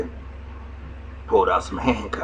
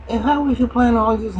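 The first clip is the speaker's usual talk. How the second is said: higher put-on woman's voice. Retelling the show, he voices the woman talking to Slim in a high pitch. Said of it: "She said".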